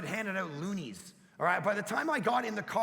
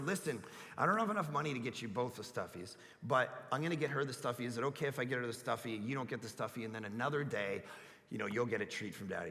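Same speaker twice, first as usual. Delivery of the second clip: much softer, lower dad voice; gentle explaining; 11 semitones lower, 8 dB quieter, faster than usual. Retold or imitated. Father quietly explaining to his daughter, soft and low. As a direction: talk her down gently, hushed.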